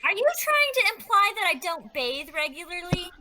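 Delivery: high, affronted challenge; indignant question. Acted. Rowan to a companion offering to clean her, indignant.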